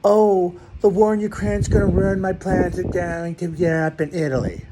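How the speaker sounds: high, whiny mock of a pundit complaining. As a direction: ham up a self-pitying celebrity whine. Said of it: "talking about".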